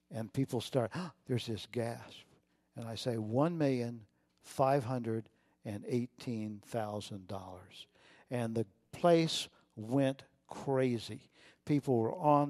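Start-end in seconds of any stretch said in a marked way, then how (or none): 3.2-7.9 slow and softer, savoring each number; slow dramatic reveal. Acted. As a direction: draw out the full sum, then drop away on "dollars". Announcing the full total.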